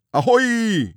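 high, loud, long sailor's call; hailing shout. A narrator's reading. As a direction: hail someone across the water. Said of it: "riep Dikke Druif".

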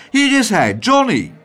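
hoog en hard uitroepen; jubelend roepend. A narrator's reading. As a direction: presenteer je zoon luidkeels. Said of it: "riep mevrouw Cocklesmith". (triomfantelijke uitroep)

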